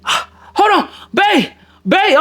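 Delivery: high, urgent voice of the woman caught in a fight; frantic girlfriend voice. Retold or imitated. Storyteller voicing his date as she gets jumped. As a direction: play her panicking on the phone.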